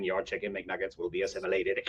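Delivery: low, soft robotic Borg. Retold or imitated. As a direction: drone it like a Borg server. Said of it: "commissary going".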